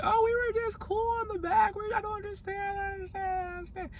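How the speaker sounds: very high, squeaky playing-innocent voice, speeding up at the end; mock Scotty. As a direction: squeak it out like a fake-innocent girl. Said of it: "Scotty was like".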